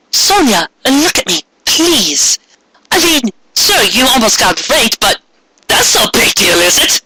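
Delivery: high, light cat voice comforting; Luna's voice. Acted. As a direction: coax her gently like a talking cat. Luna consoling Sonia in story.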